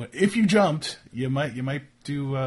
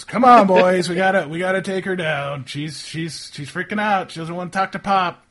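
raised, high shout to the gang; yelling; 7 semitones higher, 9 dB louder than usual. Acted. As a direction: thug calling for backup, louder at the start. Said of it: "So he yells".

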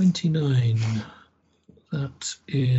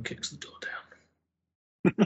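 hushed, slow whisper; 7 dB quieter, much slower than usual. Real, not acted. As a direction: whisper it so nobody inside hears. Quiet suggestion at the door.

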